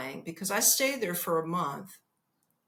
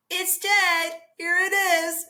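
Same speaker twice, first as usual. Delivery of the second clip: higher and louder, matter-of-fact triumph; tiny host proudly reporting; 10 semitones higher, 6 dB louder than usual. Retold or imitated. Host showing the guest the killed spider, breezy and louder. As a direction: hand over the dead spider like it's nothing. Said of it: "She goes".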